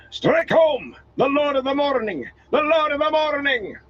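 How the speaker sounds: high rallying battle cry; shouted. A narrator's reading. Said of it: "he shouted".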